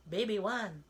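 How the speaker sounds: ordinary talk, nothing marked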